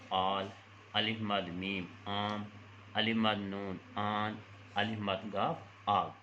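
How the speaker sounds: slow, deliberate letter-by-letter spelling; slow spelling drill. Shown as a demonstration. Teacher modelling alif madd words for children, slow and deliberate. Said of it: "کے ساتھ کوئی بھی الفاظ لگا لیں".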